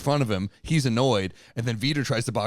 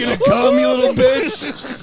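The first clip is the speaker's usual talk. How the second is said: very high, slow squeal; squeaky mock dirty talk; 7 dB louder, slower than usual. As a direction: squeal it out mockingly. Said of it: "dirty talk for you normally".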